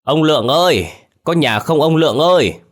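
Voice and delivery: high, loud call toward the house; old Thọ calling from the gate, high and loud. A narrator's reading. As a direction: call out across the yard. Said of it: "gọi vọng vào".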